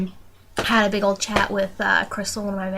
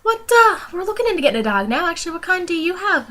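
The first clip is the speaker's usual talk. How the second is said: much higher, imitating his surprised delight; higher imitated surprise about the dog; surprised interest; 10 semitones higher than usual. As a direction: mimic an excited boss hearing about a dog. Interviewer's surprised reply retold.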